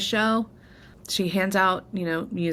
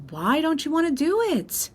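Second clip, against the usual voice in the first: mom asking; 11 semitones higher than usual. Retold or imitated. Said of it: "my mom's like".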